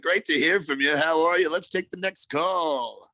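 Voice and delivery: high, hammed-up phone-line host; mock call-in host greeting; movie phone voice. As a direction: put on a loud, high announcer voice, stretching the ending. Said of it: "my little movie phone voice".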